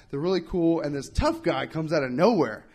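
ordinary delivery

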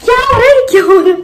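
delighted surprise at the commotion; excited. Real, not acted.

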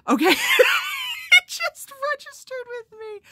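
high, loud burst of laughter; shrieking with laughter, slowed; laughing shout. Real, not acted. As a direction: crack up as the joke lands. Joke suddenly lands.

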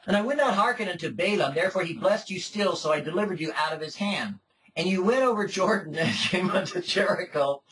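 measured, solemn Bible reading voice; formal, unhurried reading; scripture reading. Acted. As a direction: read the passage aloud to the congregation. Reads scripture aloud.

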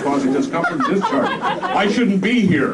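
high, whiny and loud; a high, aggrieved whine from the back; whiny Limbaugh voice. Acted. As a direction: whine it like a big baby. Limbaugh whining his excuse.

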